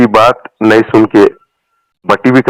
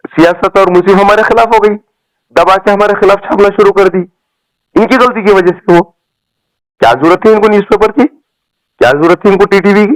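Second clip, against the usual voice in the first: heated rant; 7 semitones higher than usual. Real, not acted. Heated complaint about rival newspapers.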